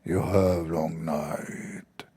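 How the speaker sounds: low, very slow, luring devil voice over music; Shaitan's voice. Retold or imitated. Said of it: "he heard Shaitan saying".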